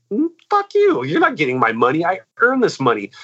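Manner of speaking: retelling how he refused the venue staff, mock defiant; defiant retort. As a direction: fling it back at them as a retold brush-off. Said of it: "And I'm like".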